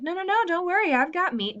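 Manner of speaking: breezy high sing-song brush-off; ironic sweet reassurance. Retold or imitated. Teacher showing the class Mary's ironic cheerful line in a high sweet voice. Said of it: "very ironic and saying".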